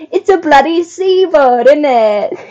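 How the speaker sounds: high, hammed-up British accent, cracking into a laugh; a bad British accent you can't keep straight. Mocking the Python line, a bad British accent. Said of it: "So bad".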